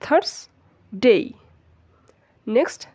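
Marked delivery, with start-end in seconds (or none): 0.0-1.2 "থার্সডে" split, "thars", pause, "dey"; model pronunciation. Shown as a demonstration. Teacher drilling learners, split-syllable model pronunciation. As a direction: separate the two syllables of "থার্সডে", glide the last. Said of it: "Repeat after me".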